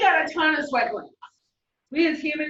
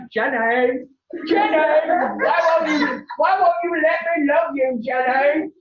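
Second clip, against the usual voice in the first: pleading husband; 4 semitones higher, 6 dB louder than usual. Retold or imitated. Husband begging the dog to love him, hammed-up loud plea. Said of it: "'Cause he's like".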